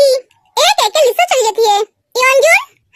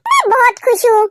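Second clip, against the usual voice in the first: ordinary talk, nothing marked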